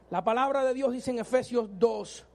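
ordinary talk

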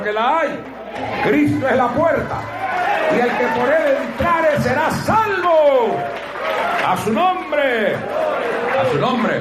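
high, slow, stretched-out exclamations; full-force preaching shout. Real, not acted. Preacher calling the church to answer, rousing shout. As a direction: rouse the congregation with a drawn-out high shout. Preacher rouses congregation to respond.

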